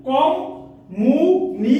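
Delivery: Communication very slow, with gaps between its parts; slow, segmented demonstration of communication; syllable by syllable. Shown as a demonstration. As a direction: break communication into separate, drawn-out chunks. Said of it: "যেটা একের অধিকবার উচ্চারণ হয়".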